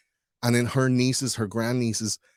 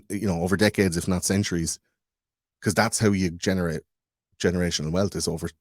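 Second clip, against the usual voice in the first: normal talking voice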